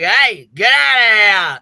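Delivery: loud, slow shouted warning; mock yell. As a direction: bark it at the guy to back off. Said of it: "So he's like".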